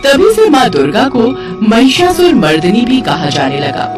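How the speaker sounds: ordinary delivery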